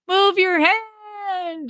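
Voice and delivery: high, long-drawn call to a partner; mock yell. Wrestler warning a partner who isn't getting it, mock yell. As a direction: holler it high, stretching the last word. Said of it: "you basically just yell to him".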